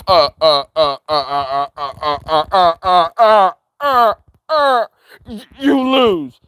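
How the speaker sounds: loud, stammering voice-changer impression; stuttering troll voice.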